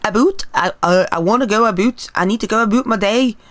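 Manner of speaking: light mock Canadian accent, stretching boot. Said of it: "what a Canadian accent is supposed to sound like".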